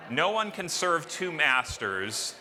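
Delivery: ordinary talk, nothing marked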